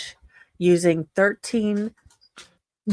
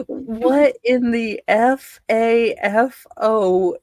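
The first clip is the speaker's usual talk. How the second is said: slow, loud, disbelieving drawl of each letter; incredulous; 4 semitones higher, 5 dB louder, slower than usual. Real, not acted. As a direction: spell it out in outraged disbelief.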